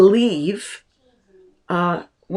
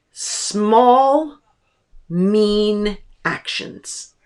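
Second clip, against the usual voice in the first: ordinary talk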